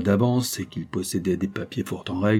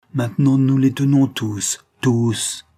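louder, higher gloating triumph; triumphant; 5 semitones higher, 5 dB louder, slower than usual. A narrator's reading. Villainess sure she has them all, loud triumph. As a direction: crow the victory out.

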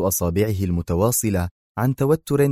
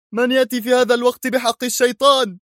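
much higher, louder, annoyed; angry at the doorbell; irritated shout; 4 dB louder than usual. Acted. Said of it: "فصاح في عصبية".

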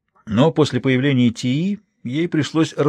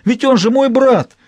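horrified protest; 12 semitones higher, 6 dB louder, slower than usual. A narrator's reading. Prince protesting brother poisoning.

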